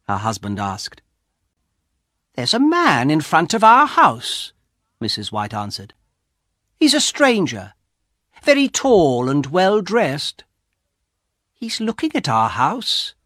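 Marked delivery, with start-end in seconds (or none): 2.3-13.1 put-on higher, curious old wife; lightly voiced wife at the window; wife's voice. A narrator's reading. Wife voiced in read story.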